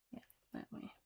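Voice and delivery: hushed whispered aside; whispering. Real, not acted. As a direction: drop to a whisper.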